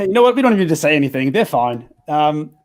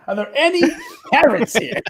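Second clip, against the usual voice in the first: laughing; 10 semitones higher than usual. Real, not acted. Asks again laughing.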